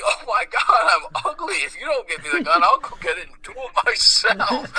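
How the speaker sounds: high-pitched hammed-up despair; imitated despairing grandpa. Grandpa distraught at being ugly, imitated despair.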